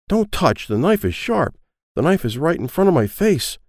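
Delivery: high, frightened little voice; boy scared of the knife, high; scared child. Acted. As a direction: shrink back from the blade.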